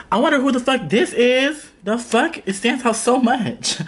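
high-pitched, playful exclaiming; mock wonder. Vlogger showing off the drawer to viewers, mock amazement.

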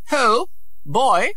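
put-on high lordly call to a boy; haughty prince. A narrator's reading. Prince ordering a schoolboy, high and haughty. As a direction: summon him like a servant. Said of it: "he said".

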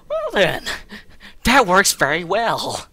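high comic voice. Acted.

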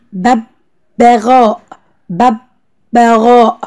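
ببغاء very slow, split as "bab-baa-ghaa"; teacher's slow dictation of ببغاء, vowels held; drawn-out syllables in ببغاء. Shown as a demonstration. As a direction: sound out ببغاء slowly with long "aa" vowels. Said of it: "الحرف الناقص عندي هو الممدود".

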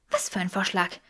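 leises, gereiztes Fauchen wie eine gepackte Katze; fauchend. A narrator's reading. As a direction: kurz angebunden zurückfauchen. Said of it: "fauchte Granger wie eine Katze". (wie eine Katze fauchen)

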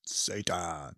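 deep demon voice. Retold or imitated. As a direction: drop way down, ominous and hushed. Ominous movie voice imitation.

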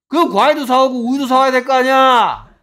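very loud, high-pitched outburst; exasperated shout. Real, not acted. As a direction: hammer home the obvious, shouting. Teacher shouts the logic at students. (답답한 듯 높고 크게 버럭)

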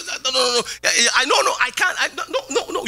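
mock panic, high flustered no no no; panicky backtracking. Preacher mimicking a bragger backing down, high mock panic. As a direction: play the bragger losing his nerve, lightly.

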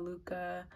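"Luca" as an alternative pronunciation, said softly; brand name pronunciation. Shown as a demonstration. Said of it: "different ways of pronouncing it".

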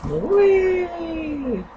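long high whee; gleeful whee. Real, not acted. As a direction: squeal it like on a slide. Character flying off ledge.

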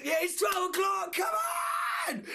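high, jubilant celebrating yell; real birthday elation, pitched high; excited shout. Real, not acted. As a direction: shout it out, thrilled. Realises the birthday has begun.

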